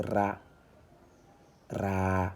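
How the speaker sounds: "ra" with an open a, held long; "ra" as a long, steady syllable. Shown as a demonstration. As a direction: draw out the a in "ra". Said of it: "le R avec la voyelle".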